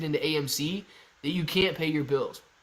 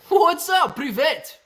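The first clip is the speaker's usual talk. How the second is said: excited greeting; 12 semitones higher, 7 dB louder, slower than usual. Real, not acted. Greeting a viewer from Russia.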